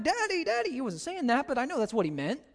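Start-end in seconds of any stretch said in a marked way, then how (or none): 0.0-0.7 put-on much higher, whiny little-boy cry for daddy; imitating a crying baby. Father mimics his crying baby son calling for him, high wail.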